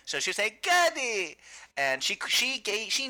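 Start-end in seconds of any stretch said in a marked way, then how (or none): 0.6-1.4 put-on high calling Gary in Polish accent. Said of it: "thick Polish accent".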